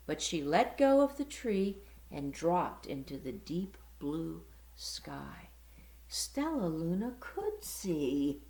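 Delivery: ordinary delivery, nothing marked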